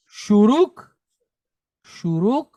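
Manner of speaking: "Shuruk" said slowly and clearly, long u sounds; model shuruk for repeating. Shown as a demonstration. Teacher models "shuruk" for class to repeat, clear and slow. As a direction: say "shuruk" so the class can echo it. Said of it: "Say it with me".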